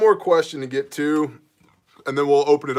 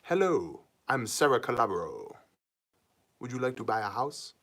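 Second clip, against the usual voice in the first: lightly voiced agent, low and soft; a hint of a smooth sales pitch; real estate agent; 3 semitones lower, 8 dB quieter than usual. Mock agent greets a house buyer, lightly voiced sales pitch. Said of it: "that's like a good real estate name".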